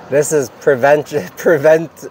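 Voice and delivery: stumbling through the word while laughing. Real, not acted. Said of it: "laughs while stumbling".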